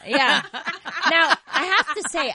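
loud, high laugh through the words. Real, not acted. Host laughing at her co-host's joke, loud and high. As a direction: still cracking up as she talks.

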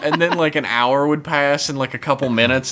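usual speaking voice

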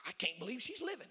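imitated spluttering, trailing into disbelief; stuttering disbelief. Young student to his pastor about the woman, mock shocked stammer. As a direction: stammer softly, then blurt it out aghast.

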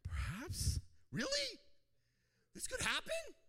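hammed-up wonder; amazed. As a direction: gasp with disbelieving hope. Acting amazed believer.

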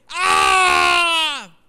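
piercing high howling shriek, full force; demonic scream. Retold or imitated. Preacher acting out the possessed man interrupting Jesus, piercing demonic scream. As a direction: let the demon burst out in one long wild cry. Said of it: "un hombre con un demonio grita en medio de todos".

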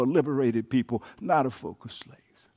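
ordinary talk, nothing marked

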